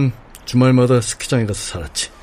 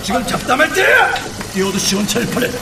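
harsh barking commands; 4 semitones higher than usual. Acted. Chef yelling at chatting staff.

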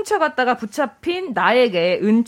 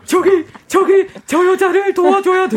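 urgent calling out; 6 semitones higher, 4 dB louder than usual. Acted. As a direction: call out to strangers urgently. Acted bystander calling out. (다급하게 외치는 목소리)

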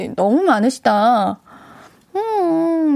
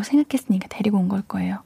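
confiding whisper; 4 semitones lower, 6 dB quieter, faster than usual. Acted. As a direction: lean in and whisper it like a secret. Confiding aside about the daughter. (비밀 얘기하듯 속삭이며)

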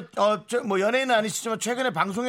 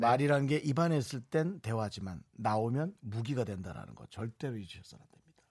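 solemn quote; 6 semitones lower, 8 dB quieter than usual. Acted. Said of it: "갑자기 명언 타임을 하세요".

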